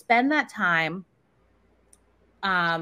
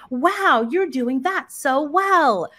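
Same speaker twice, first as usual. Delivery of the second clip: higher and louder, slow, gushing "Wow"; excited praise; 5 semitones higher, 4 dB louder, much slower than usual. Shown as a demonstration. Parent praising child during play, excited. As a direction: cheer the child on with big praise. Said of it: "and then also praising".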